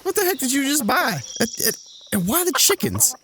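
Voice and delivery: high, incredulous pitch; can't believe what he's seeing; exasperated disbelief. Acted. Brother scolding Earl for buying chickens, incredulous.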